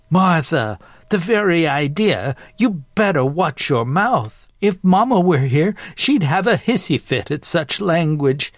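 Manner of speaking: high, indignant Southern matron; scandalized scolding great-aunt. Acted. Great-aunt scolding her sister about crude language, scandalized. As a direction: scold like a shocked Southern lady.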